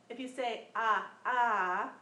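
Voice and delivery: ah sounded from the throat, loud and open; guttural ah. Shown as a demonstration. Teacher shows the guttural ah, loud and open. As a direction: push the ah out from the back of the throat. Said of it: "it's a guttural sound".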